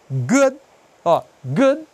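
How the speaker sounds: "good" ending on a plain d; bare final D. Shown as a demonstration. Teacher modelling the word, no I after "good". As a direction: stop "good" on the d, no ee. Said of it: "não é good".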